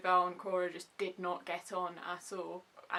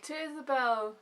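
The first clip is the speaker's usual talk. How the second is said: raised, lifted toasting voice; group toast; 4 semitones higher, 3 dB louder than usual. Acted. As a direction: raise a glass as the whole party. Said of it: "everyone's like".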